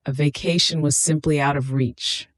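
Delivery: usual speaking voice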